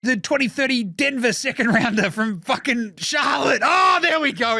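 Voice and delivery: high frantic excited rant; excited Presti. Retold or imitated. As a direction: squeal it out, giddy and high. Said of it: "He's like".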